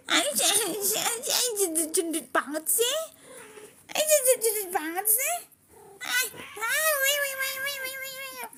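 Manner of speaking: very high squeaky cooing; gushing high-pitched babble. Real, not acted. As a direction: tease a chubby pet in a tiny squeal. Playing with and teasing fat cat.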